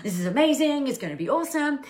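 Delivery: imitating an overexcited, pumped-up self; pumped-up excitement. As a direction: sell it like you're amped about a big launch. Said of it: "if you're really excited and really pumped and amped".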